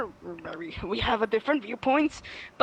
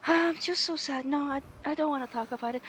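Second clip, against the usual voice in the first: high whiny mock sad voice; mocking attention seeker; 5 semitones higher, 4 dB quieter than usual. Mocking a fake-sad attention seeker, whiny.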